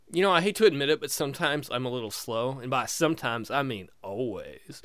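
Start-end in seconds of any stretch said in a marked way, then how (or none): none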